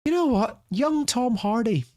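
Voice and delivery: a little nostalgic sigh of approval; mock fond.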